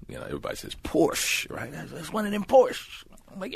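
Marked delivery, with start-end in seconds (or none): 1.7-3.0 high-pitched imitated sheriff; mock country lawman; small-town sheriff. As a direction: puzzle it out in a high local voice. Sheriff naming the car.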